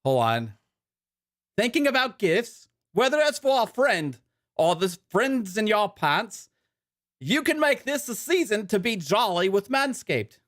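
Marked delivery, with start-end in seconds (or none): 1.6-10.4 high-pitched mock British ad read, slipping toward Australian; wobbly British-Australian presenter voice, pitched up; put-on British accent. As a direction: read the sponsor copy in a hammed-up British accent. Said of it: "do it in a British accent".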